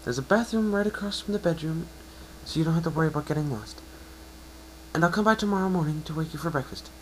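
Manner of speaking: high, bright-voiced mare, encouraging; a kind unicorn reassuring her patient; Twilight's voice.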